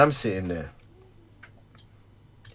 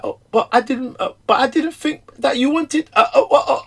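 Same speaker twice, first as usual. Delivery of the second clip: flustered excuse; 12 semitones higher than usual. Retold or imitated. Imagined defensive reply.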